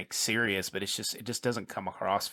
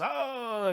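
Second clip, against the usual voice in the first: mock cry; 12 semitones higher than usual. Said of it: "He goes, he goes".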